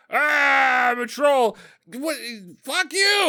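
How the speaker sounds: high, hammed-up outburst; mock ambush yelling. Troll popping out at Mega Troll, high mock yelling. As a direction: yell it like a sketch of a botched surprise.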